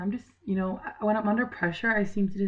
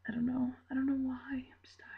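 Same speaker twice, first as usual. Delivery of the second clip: drained, breathy whisper, much softer; tired whisper; 4 semitones higher, 9 dB quieter than usual. Real, not acted. Vlogger trailing off, tired today, in a whisper. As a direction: let it fade into a tired whisper. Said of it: "I'm just, like, tired today".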